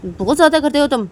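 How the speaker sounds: loud, high-pitched scolding; angry outburst. Acted. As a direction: snap it loudly at them. Acted quarrel, loud outburst.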